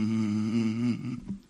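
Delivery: a flat, empty hum of nothing; zero's hum. Pretend. A zero's hum to show the company did nothing. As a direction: hum like a zero given a voice. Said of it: "That's a zero if it had a voice".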